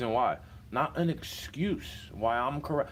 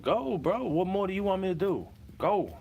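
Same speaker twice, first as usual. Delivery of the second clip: higher-pitched, exasperated pleading; exasperated retort; 6 semitones higher than usual. Retold or imitated. Telling a tailgater to go ahead, exasperated and higher. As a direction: plead upward, fed up with the other driver. Retold roadside row with tailgater.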